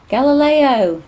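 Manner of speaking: loud high call across the beach; shouting a bird's name from a distance. Acted. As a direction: call the bird in from afar. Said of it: "Nim called".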